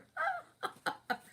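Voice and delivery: high-pitched burst of laughter; tickled, high laugh. Real, not acted. Streamer amused by friend's annoyance, high laughing.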